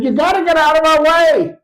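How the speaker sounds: high, loud exasperated shout; frustrated Santa. Acted. Santa to the angel blocking his sleigh, frustrated shout. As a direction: yell to clear the path. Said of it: "ignored his frustration".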